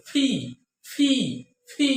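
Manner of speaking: repeated "ফি" with a puff between both lips; two-lip aspirated P. Shown as a demonstration. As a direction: pop "ফি" out with breath, pausing between each. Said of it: "দুই ঠোঁটের মধ্যে".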